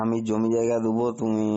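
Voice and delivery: ordinary talk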